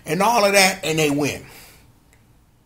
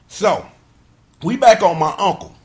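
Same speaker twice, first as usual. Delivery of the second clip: ordinary talk, nothing marked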